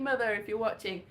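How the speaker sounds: normal talking voice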